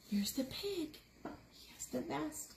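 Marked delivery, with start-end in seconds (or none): none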